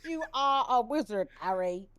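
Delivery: laughing terrible British impression; bad British accent. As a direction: drawl it out while laughing. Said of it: "so bad".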